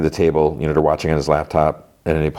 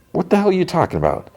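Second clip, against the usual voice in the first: high-pitched incredulous comeback; indignant disbelief; 13 semitones higher than usual. Retold or imitated. Accused actor answers his old co-star, high-pitched indignation. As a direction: act out being affronted, pitch jumping up.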